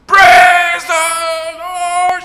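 long, high, ringing shout; screamed praise. Acted. Old lady screams praise, waking the neighbours, long high shout. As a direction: stretch the praise out to the heavens. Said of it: "She screams out".

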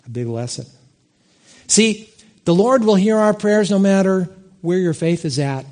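ordinary delivery

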